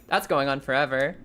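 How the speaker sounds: ordinary talk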